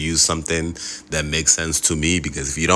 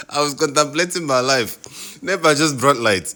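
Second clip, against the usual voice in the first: laughing; 10 semitones higher than usual. Real, not acted. Laughing as power returns.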